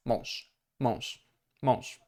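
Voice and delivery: "mąż" with its end devoiced to sh. Shown as a demonstration. Teacher repeating the example word, devoiced ending. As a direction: repeat "mąż" as monsh, evenly. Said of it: "the softer version at the end of the word".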